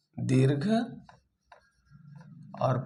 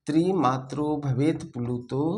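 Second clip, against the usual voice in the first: ordinary talk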